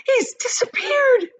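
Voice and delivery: high, loud, slow panicked yell. A narrator's reading. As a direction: cry out in horror. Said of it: "yelled Missus Gloop".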